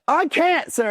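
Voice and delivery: very high desperate mock wail; high-pitched comic cry of protest; recruit yelling. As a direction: imitate a straining recruit pleading with the instructor. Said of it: "like, yelling, not crying".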